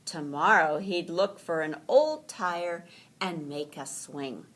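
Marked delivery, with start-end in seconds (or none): none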